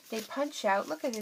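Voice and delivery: usual speaking voice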